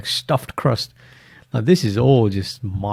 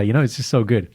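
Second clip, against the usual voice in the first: ordinary talk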